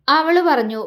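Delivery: ordinary delivery, nothing marked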